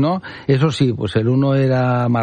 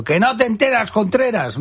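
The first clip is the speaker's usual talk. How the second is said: grito imitado; 8 semitones higher than usual. Retold or imitated. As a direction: imitate a heckler's shout at a raised pitch. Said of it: "alguien dijo gritando". (grito imitado en tono agudo, sin alzar el volumen)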